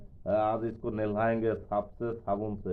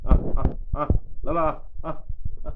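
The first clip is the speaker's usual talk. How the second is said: calling animals; 6 semitones higher, 8 dB louder, much slower than usual. Real, not acted. Calling animals to come and eat.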